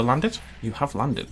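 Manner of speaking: ordinary talk, nothing marked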